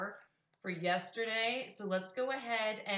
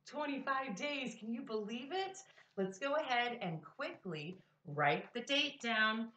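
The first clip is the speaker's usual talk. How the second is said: ordinary talk, nothing marked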